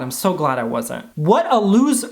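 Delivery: ordinary talk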